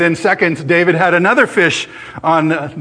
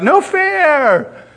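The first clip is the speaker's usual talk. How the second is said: put-on high, wailing child's cry of protest; a small girl crying out that it's unfair; hammed-up high child voice, tearful; upset little girl; 11 semitones higher than usual. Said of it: "burst into tears and cried out".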